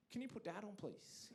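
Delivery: soft, fading to a whisper; meek request. Retold or imitated. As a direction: shrink away as you ask for Dad. Scared kid asks for Dad.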